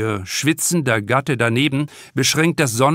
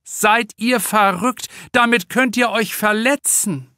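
upset overprotective mother; 9 semitones higher than usual. Acted. Mother scolding the children over the dangerous shovel, high alarmed voice. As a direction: scold the kids as if the shovels were weapons. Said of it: "Aufgebracht zeigt sie auf die Kinderschaufel".

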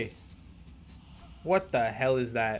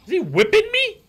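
shocked squeal; 6 semitones higher, 6 dB louder than usual. Real, not acted. Panicked disbelief at enemy.

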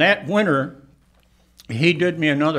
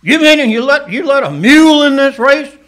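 loud, higher outraged protest; outraged cowboy; 10 semitones higher, 12 dB louder than usual. Retold or imitated. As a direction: sputter it out like a furious rich cowboy. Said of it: "And he says".

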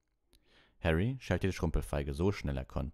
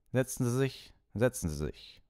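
mildly indifferent professor, high start dropping to an even tone; an unbothered teacher's casual order; 8 semitones higher than usual. A narrator's reading. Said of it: "sagte Professor Snape gleichmütig".

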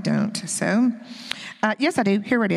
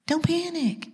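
voicing the Lord; 7 semitones higher, slower than usual. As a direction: say it as God calming a frightened child. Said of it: "the Lord is saying".

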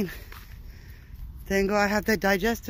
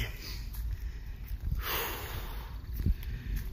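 drawn-out weary sigh; long sigh; 10 dB quieter than usual. Real, not acted. Vlogger sighing after telling viewers he still bleeds. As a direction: breathe out all the exhaustion.

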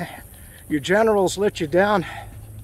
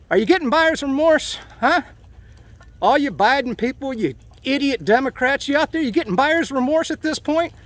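raised pitch, indignant challenge; heated taunting; 7 semitones higher than usual. Real, not acted. Vlogger challenging Biden voters about their choice, heated and taunting. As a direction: demand an answer from them, pitched well up. Taunting political opponents in a rant.

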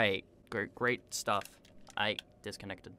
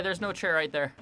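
ordinary delivery

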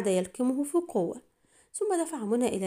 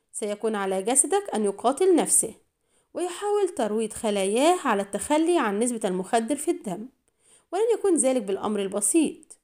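ordinary delivery

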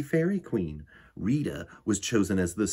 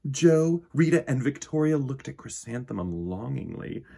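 plain reading voice

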